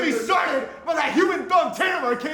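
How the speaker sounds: shout. Acted. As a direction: yell it in a rage. Diva rant.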